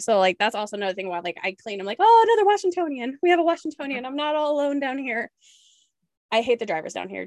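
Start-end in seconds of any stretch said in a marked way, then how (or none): 2.0-5.3 excited greeting. Retold or imitated. Excited at fellow Washingtonian.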